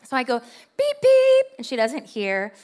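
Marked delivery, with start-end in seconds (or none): 0.4-1.5 high, loud mock horn beeps; playful beep beep. Wife beeping at a lady blocking the aisle, in a high, loud playful voice. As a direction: honk like a little car.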